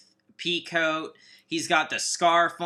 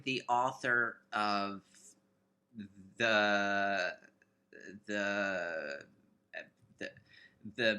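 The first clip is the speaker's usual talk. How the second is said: lightly voiced romance author; sultry narrator; 6 semitones lower, 4 dB quieter than usual. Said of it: "This is very sultry".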